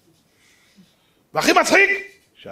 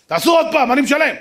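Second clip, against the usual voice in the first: louder and higher, insistent; demanding; 8 semitones higher, 7 dB louder than usual. Retold or imitated. Sick father insists doctors test again, loudly. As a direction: push the demand hard.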